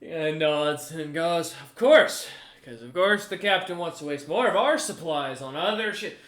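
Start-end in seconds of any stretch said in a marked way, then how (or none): 1.5-6.1 high, loud ranting with swooping pitch; exasperated cook. Acted. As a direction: rant as the overworked ship's cook. Cook rants about wasted supplies.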